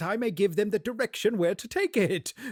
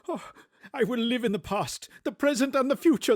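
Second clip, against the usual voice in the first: joyful vow; 6 semitones higher, faster than usual. Acted. Scrooge repeats the vow joyfully.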